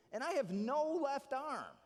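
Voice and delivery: slow emphatic plea. Retold or imitated. Student pleads to master.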